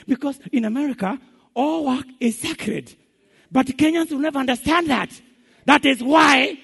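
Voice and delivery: loud, high, building emphasis; climbing preacher shout; rising to a shout. Real, not acted.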